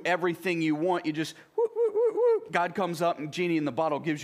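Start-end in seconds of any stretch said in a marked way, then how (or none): 1.6-2.5 very high, quick repeated whoops; playful whooping noise. Pretend. Preacher mocking a wish-granting idea of God, playful high whoops. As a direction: imitate a magic summoning with high whoops. Mimes summoning a genie on demand.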